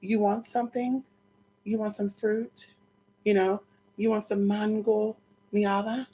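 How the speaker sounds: lightly voiced suitor tempting her gently; soft offering. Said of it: "He would say".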